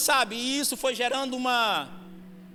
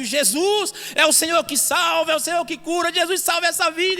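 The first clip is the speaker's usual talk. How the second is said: louder, higher pleading prayer; fervent prayer; 6 semitones higher, 6 dB louder than usual. Acted. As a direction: cry out to Jesus over the family.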